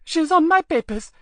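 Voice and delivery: a mother loudly pleading with the guard; begging. A narrator's reading. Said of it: "the mother begged".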